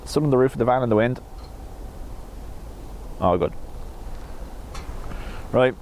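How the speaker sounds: ordinary talk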